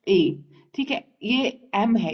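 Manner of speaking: ordinary talk, nothing marked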